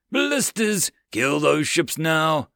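high, slow barked order; commanding shout. Acted. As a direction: command the gunners. Captain orders attack.

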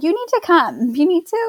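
urgent insistence. Retold or imitated. Midwife calling couple back to come in, mildly urgent retelling.

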